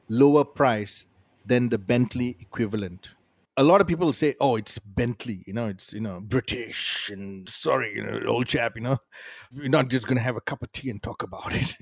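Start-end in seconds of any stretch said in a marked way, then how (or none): 4.4-11.7 lightly put-on English accent, low and soft; hint of a hammed-up English chap; mock English accent. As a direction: play at an English gent. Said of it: "the English accent".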